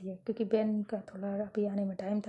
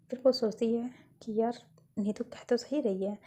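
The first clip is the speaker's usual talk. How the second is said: plain reading voice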